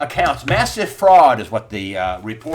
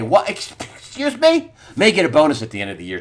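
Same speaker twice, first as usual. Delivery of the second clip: usual speaking voice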